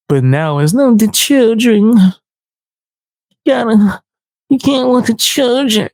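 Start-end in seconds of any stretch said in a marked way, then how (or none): 0.7-2.0 high, drawn-out mock outrage; mocking plea. Mocks her plea about the children. 2.0-5.9 high-pitched mock pleading; mocking plea. Mocks her plea about the children.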